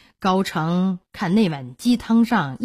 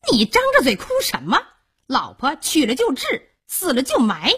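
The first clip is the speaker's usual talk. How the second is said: raised, scornful wife berating him; callous scolding; 6 semitones higher than usual. A narrator's reading. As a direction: scold a grieving man without pity, pitched high. 萧娥斥责痛哭的孔目. (冷酷地高声训斥)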